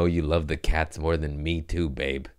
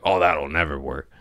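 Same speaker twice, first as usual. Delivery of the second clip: negative attitude; 3 semitones lower than usual. Retold or imitated. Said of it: "they have a bad attitude".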